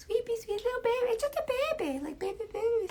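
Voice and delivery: tiny, very high, soft voice; baby talk. Pretend. Showing viewers baby-light brush strokes, cooing. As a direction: coo it high, like to an infant.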